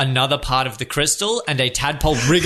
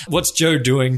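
normal talking voice

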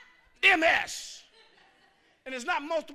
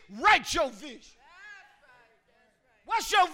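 full-force preaching; 5 semitones higher, 13 dB louder than usual. Real, not acted. Pastor exhorting the congregation, full-force preaching. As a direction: preach it to the back row.

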